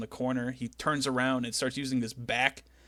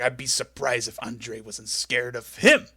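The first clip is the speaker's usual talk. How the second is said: Macho Man impression; 5 dB louder, slower than usual. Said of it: "He's like".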